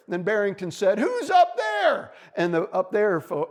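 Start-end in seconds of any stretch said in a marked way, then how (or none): 1.0-2.1 high, loud, drawn-out holler; calling up. A narrator's reading. Bunny asks who is in the tree, hollering. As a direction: stretch the question up to the treetop.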